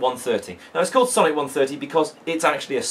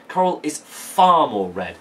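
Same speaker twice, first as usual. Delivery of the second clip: slow, far more leaned on; stress on far more; slower than usual. Shown as a demonstration. Teacher reads the line to show where to look, far more stressed. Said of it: "I pick on the words far more".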